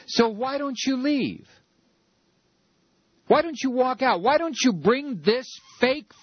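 furious radio host rant; angry rant. Real, not acted. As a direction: shout the challenge at the Democrats, fed up. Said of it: "I don't know why I'm getting so upset".

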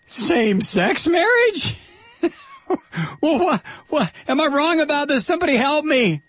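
high-pitched, baffled outburst; theatrical incredulity. Pretend. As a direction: plead for help in mock bewilderment. Incredulous at the religions' hypocrisy.

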